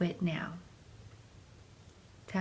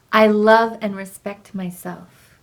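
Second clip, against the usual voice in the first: strong affirmation; 12 dB louder than usual. Acted. Affirmation during tapping.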